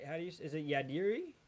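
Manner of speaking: ordinary talk, nothing marked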